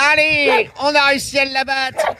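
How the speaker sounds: high, crowing cheer; high-pitched playful celebration; mock triumph. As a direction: announce a victory over a giant. Mock triumph over the giant dog.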